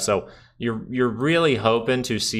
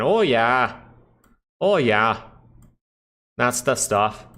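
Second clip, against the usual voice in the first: put-on Canadian accent, "Oh" stretched and leaned on; hammed-up Canadian accent; slower than usual. Streamer hamming a Canadian accent for chat. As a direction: play up the Canadian "Oh, yeah". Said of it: "Why do I sound like a Canadian?".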